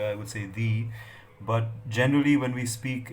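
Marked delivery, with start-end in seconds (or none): 0.5-0.9 "the" said in full as "thee"; strong form "the" pronounced "thee"; emphasized the. Shown as a demonstration. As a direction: stress the article "the" as a long "thee". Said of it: "when I emphasize this word here".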